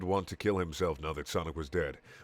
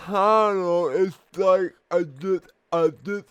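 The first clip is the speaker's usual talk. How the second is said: higher, louder, faltering held-back tears; trying not to cry; 9 semitones higher, 8 dB louder, slower than usual. A narrator's reading. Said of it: "trying not to cry".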